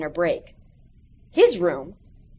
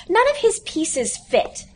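higher, slow whiny grumble; complaining; 10 semitones higher, slower than usual. A narrator's reading. Classmates grumble about Audie's pieces, slow and whiny. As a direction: drag out the complaint. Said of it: "several of his classmates complained".

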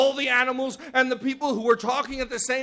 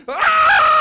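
long, high, loud wail; sustained falling-animal scream; leopard's cry; 10 dB louder than usual. Acted. Leopard falls into the pit, long loud cry. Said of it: "we all heard him cry out".

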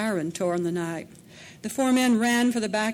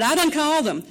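loud, high shout, pressing the threat; desperate high yell; 8 semitones higher, 8 dB louder, faster than usual. A narrator's reading. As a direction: hammer it home at full voice. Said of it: "she shouted".

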